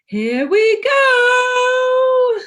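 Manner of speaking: very high, slow, loud sung whoop; gleeful sing-song. A narrator's reading. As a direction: sing out with joy on the ride. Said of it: "said Paddington".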